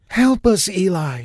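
high, loud urgent demand; insist, loud and high. Acted. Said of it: "she demanded".

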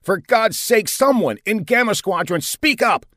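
high, exasperated radio call; desperate demand. A narrator's reading. Commander begging the silent squadron to answer, exasperated. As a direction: plead angrily for any answer.

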